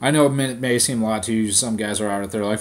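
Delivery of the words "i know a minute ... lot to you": ordinary delivery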